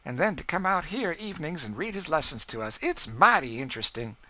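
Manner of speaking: put-on high, loud old cook's voice; Aunt Chloe voice. A narrator's reading. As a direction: talk proudly of Massa George.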